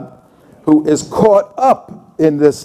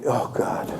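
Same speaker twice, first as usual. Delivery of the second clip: breathed oh God, much softer; dread whisper; 4 semitones lower, 12 dB quieter than usual. Real, not acted. Imagining those people in charge, in a whispered, much softer aside.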